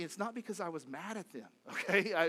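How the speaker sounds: ordinary delivery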